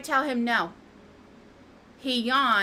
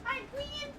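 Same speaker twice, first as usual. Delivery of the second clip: cooing pet talk; 12 dB quieter than usual. Real, not acted. Greeting cat in baby voice.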